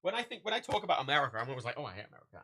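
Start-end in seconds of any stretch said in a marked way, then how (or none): 1.8-2.4 low, soft disgusted aside; ugh sense. Retold or imitated. Vlogger quoting own usual complaint, mild ugh sense. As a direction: quote yourself with a weary touch of disgust. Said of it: "in this sort of, like, ugh sense".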